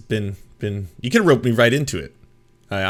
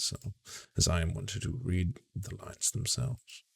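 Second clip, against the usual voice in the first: soft, musing intellectual impression; mock a pundit in a quiet murmur; Christopher Hitchens; 3 semitones lower, 12 dB quieter than usual. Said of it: "Christopher Hitchens loved to be like".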